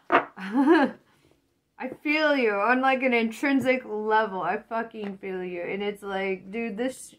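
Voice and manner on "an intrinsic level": ordinary talk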